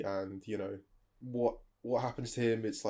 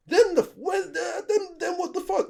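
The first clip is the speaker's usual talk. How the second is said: sudden high squeal of outrage; shrieking disbelief; 5 dB louder than usual. Real, not acted. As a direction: shoot way up into a squeaky cry. Outraged disbelief at mum's plan.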